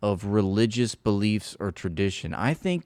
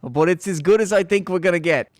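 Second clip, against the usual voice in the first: mock quote; 7 semitones higher, 6 dB louder than usual. Repeats quote louder, mocking.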